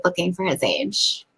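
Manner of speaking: hushed, barely voiced confession; whispering. Real, not acted. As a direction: whisper it like a secret. Whispered confiding exchange.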